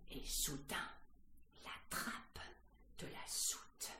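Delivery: halting whisper, word by word; suspenseful whisper. A narrator's reading. Suspense as the hatch opens. (chuchotement plein de suspense)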